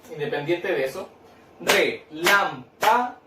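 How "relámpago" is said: "Relámpago" slowly in four separate beats; sílaba por sílaba. Shown as a demonstration. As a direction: count out the syllables of "Relámpago", re-lam-pa-go, slowly. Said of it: "¿Dónde cargo la voz?". («Relámpago» lento, marcando cada sílaba por separado)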